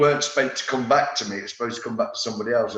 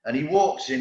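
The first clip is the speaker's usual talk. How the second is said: normal talking voice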